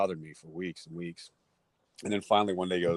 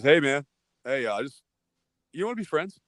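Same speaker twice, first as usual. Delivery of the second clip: sheepish; 5 semitones higher, 6 dB louder than usual. Retold or imitated. Bully sheepishly asking to be friends.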